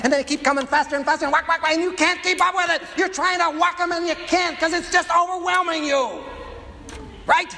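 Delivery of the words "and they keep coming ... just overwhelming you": climbing higher and into a shout as the moles get faster; mounting frantic excitement. Acted. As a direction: build from quick to an overwhelmed cry. Game speeds up, preacher builds.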